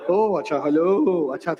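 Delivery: a timid, mumbled hello to a phone call; lightly mimics an unsure office worker picking up; hesitant hello. How the audience answered the ringing phone, a mumbled unsure hello. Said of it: "See, this was not the right approach".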